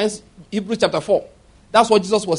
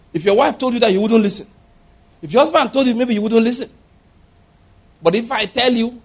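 ordinary talk, nothing marked

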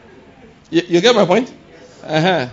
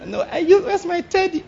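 put-on high-pitched, whiny pampered-girl voice; 13 semitones higher than usual. Preacher mocks university girl wanting her teddy, childish whine. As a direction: whimper it, mocking a spoilt student. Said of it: "funny things, do ajebota".

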